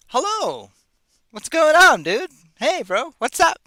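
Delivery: high, loud, mock-chummy hello; hammed-up casual greeting. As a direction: greet a bro with exaggerated cheer. Jokey version of Rama's hello.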